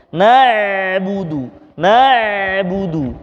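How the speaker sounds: na'budu drawn out slowly with a flowing ain; slow model of na'budu; careful ain. Shown as a demonstration. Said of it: "harus tepat mengucapkannya".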